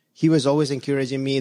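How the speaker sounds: usual speaking voice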